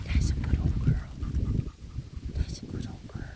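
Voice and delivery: breathy hushed praise; whispered praise. Real, not acted. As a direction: coo it under your breath. Soothing dog in near whisper.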